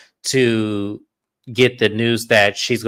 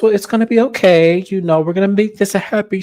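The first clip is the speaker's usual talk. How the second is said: higher-pitched imitation of someone comforting; mock reassurance; 7 semitones higher than usual. Host voices chef consoling castmate, high mock reassurance. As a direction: soothe her in a raised, put-on voice. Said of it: "And he goes".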